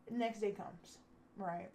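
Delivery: ordinary talk, nothing marked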